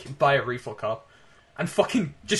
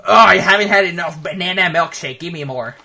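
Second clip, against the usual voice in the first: mock greedy customer; 4 semitones higher, 8 dB louder than usual.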